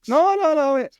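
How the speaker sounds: loud, very high-pitched protest; high squawk of objection; panicked protest. Real, not acted. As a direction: shoot up high to take back a blunder. Protests after misreading the question.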